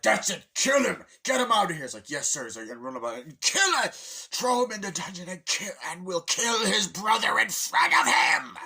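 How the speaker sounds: high, loud raging commands; furious orders. Retold or imitated. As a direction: bellow orders at the guards. Bowser ordering execution, shouting.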